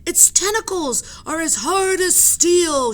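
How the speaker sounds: high, loud, slow warning; frightened shout. Acted. Sailor shouts to the crowd in alarm, high and loud. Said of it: "shouted one of the sailors".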